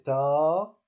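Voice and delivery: long, sustained "ta"; prolonged vowel. Shown as a demonstration. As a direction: hold the vowel of "ta". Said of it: "Donc c'est prolongé".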